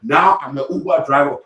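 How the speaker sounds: normal talking voice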